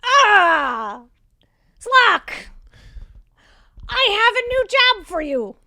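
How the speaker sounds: high, loud, shrill boss voice; shrill yelling. Acted. Boss summons her enforcer for a new job, shrill yell. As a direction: shriek the summons like a small, commanding boss. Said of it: "her shrill voice gives her a power".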